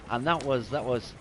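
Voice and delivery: normal talking voice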